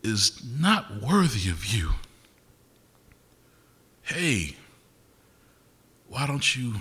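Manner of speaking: slow, measured recital with long pauses; spoken-word piece. Acted. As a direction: recite it deliberately, line by line.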